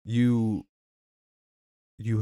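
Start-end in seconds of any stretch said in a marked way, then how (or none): none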